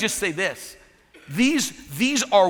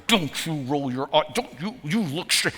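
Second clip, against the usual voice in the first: scolding husband. Retold or imitated. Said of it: "it's not me going to her".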